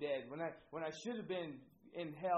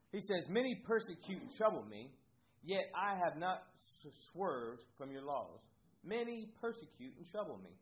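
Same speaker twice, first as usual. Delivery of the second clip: louder, declaimed reading of the verse; scripture reading; 3 semitones higher, 5 dB louder than usual. Acted. Pastor reading the psalm aloud to the congregation, declaiming. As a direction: proclaim the verse to the church.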